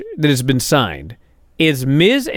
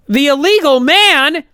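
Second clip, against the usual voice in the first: incredulous; 8 semitones higher than usual. Real, not acted. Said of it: "incredulous echo".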